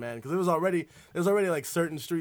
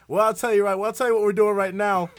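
cousin's voice; 6 semitones higher, 9 dB louder than usual. Retold or imitated. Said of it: "They're like".

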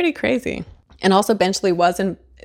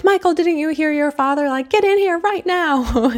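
mock calling out; 9 semitones higher than usual. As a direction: call your son in, hammed-up urgency high in the voice. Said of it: "she calls out to her son being like".

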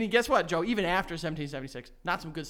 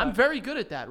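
smug; 5 semitones higher, 5 dB louder, faster than usual. Acted. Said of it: "why the hell did you say that like that".

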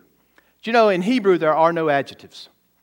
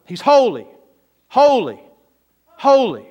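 holy, holy, holy building higher and louder; triple holy; 5 semitones higher, 5 dB louder than usual. Shown as a demonstration. Preacher showing why holy is said three times, rising louder. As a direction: climb with each holy, proclaiming. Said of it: "We gotta say it three times".

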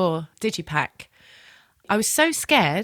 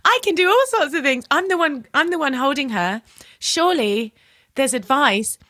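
defiant; 6 semitones higher than usual. Retold or imitated. Mum retelling how she argued with her doctor, defiant and high. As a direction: push back at the doctor, fired up. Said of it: "And I just said".

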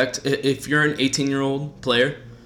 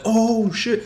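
high, excited stunned yelp; mock crowd exclamation; crowd gasp; 10 semitones higher than usual. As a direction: react like a drunk fan seeing stars. Crowd reacting to stars.

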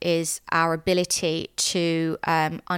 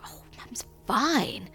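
higher, whispery false reassurance; retold reassuring lie; 6 semitones higher, 4 dB quieter, slower than usual. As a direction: retell a gentle cover-up, airy and drawn out. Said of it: "And I lie and I'm like".

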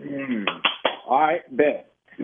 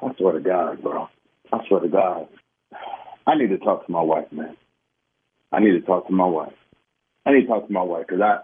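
seething low; 12 semitones lower than usual. Real, not acted. Husband seething, voice drops low.

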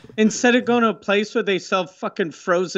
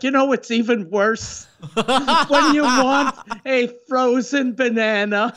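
mock complaint; 7 semitones higher than usual. Mocking Demi in a support meeting.

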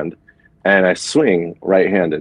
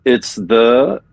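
ordinary talk, nothing marked

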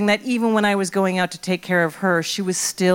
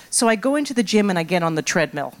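ordinary talk

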